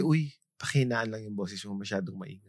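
low, softened voice, as if privately; discreet hushing request. Acted. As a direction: quietly ask someone to tone it down. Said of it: "ikaw ba yung type na nagsasabi".

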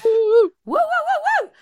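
high, loud whooping cheer; excited cheer. Real, not acted. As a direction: whoop it up for the show opening. Cheering the show intro.

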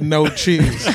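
mildly firm, deliberate restatement; pointed insistence. Retold or imitated. Diner retells ordering from the lady, mildly pointed insistence. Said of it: "I looked the lady in the face".

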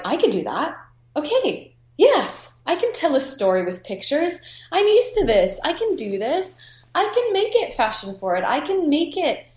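light up as the idea lands; excited. Retold or imitated. Sudden excited realization.

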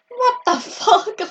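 high, slow, incredulous what the fuck; shocked barmaid, outraged at a false accusation; stunned disbelief. Retold or imitated. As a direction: drag it out in total disbelief. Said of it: "I was stood there like".

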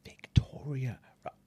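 whisper. Real, not acted. Whispered hint.

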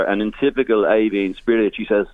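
normal talking voice

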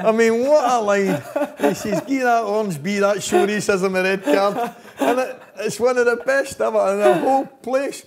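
high, loud delight with real laughter; laughing. Real, not acted. Storyteller repeating Tam's best line, laughing. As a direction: savour the line while still cracking up.

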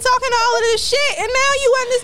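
high loud mocking shout; mock yelling callout. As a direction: yell it out in mock outrage. Mock callout of macho man.

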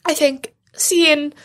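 fighting back real tears, halting with long pauses; tearful, choked up. Real, not acted. Telling a friend how far she has come, tearful and choked up. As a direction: voice giving out into whispery sobs. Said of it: "I said I'd cry".

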